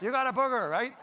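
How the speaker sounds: laughing repeat. Retold or imitated.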